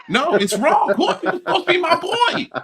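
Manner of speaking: high-pitched, laughing through the words; laughing protest. Real, not acted. Host laughing at a chat joke, laughing protest. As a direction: protest while cracking up.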